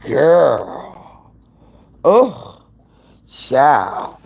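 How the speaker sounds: ordinary talk, nothing marked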